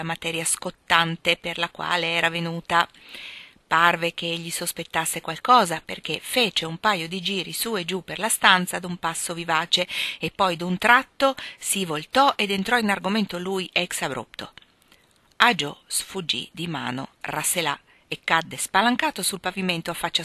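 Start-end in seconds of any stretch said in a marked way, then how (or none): none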